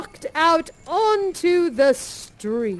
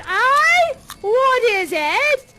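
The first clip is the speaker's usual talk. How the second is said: put-on higher, louder voice of a street boy; a startled young lad answering; lightly voiced young boy; boy's voice; 10 semitones higher, 8 dB louder, slower than usual. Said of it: "And the boy says".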